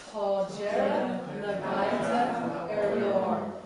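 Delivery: slow, loud choral recitation; loud, measured chanting for repetition; group recitation lead. Shown as a demonstration. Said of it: "let's say that one together".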